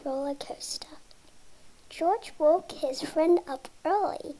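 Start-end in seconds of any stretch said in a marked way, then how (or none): 0.0-1.0 whispered title. A narrator's reading.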